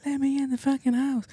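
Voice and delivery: high-pitched imitation of an arguing woman; mock yelling woman. Man imitating the lady arguing outside, high mock yell. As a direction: squeal it like the angry lady outside. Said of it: "she's like".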